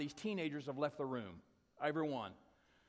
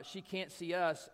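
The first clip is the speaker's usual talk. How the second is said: ordinary delivery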